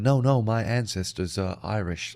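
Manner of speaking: low, slow mock American reply; imitated American. American defending an Irish claim when challenged, imitated American. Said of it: "annoys me most about Americans".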